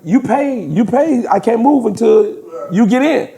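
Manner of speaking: high-pitched imitation of the gondolier; gondola driver. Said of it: "dude was like".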